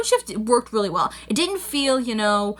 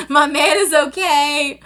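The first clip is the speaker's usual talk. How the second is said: relieved laughing drawl; 5 semitones higher, 4 dB louder, slower than usual. Real, not acted. Repeats it, laughing and drawn out.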